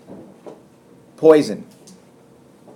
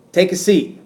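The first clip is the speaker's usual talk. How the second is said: higher, louder order, punch on "Take"; commanding; 5 semitones higher, 4 dB louder than usual. Shown as a demonstration. Teacher showing an imperative reading of "Take a seat". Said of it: "simply by the way it's said".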